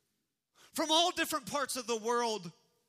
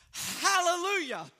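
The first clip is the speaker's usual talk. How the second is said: proclaimed hallelujah; 7 semitones higher, 3 dB louder than usual. Acted. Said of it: "it says this".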